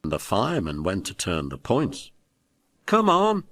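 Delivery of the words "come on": high, loud call to the driver. A narrator's reading. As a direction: shout him over, up high. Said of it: "waved the fireman".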